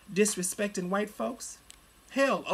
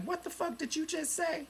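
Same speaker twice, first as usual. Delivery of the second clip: mock-offended, pitched way up; outraged retort; 10 semitones higher, 3 dB quieter than usual. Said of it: "I'm like".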